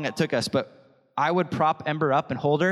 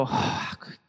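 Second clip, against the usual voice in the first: mock disappointed whisper; 5 dB quieter, slower than usual. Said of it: "We don't go".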